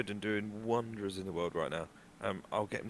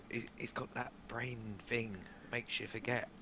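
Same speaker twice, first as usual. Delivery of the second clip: hushed, slow confidential explanation; whisper; 12 dB quieter than usual. Acted. Explaining Bongo's forgetfulness discreetly to a bystander, hushed whisper. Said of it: "and I whisper".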